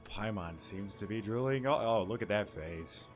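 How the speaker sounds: ordinary delivery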